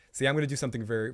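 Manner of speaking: ordinary delivery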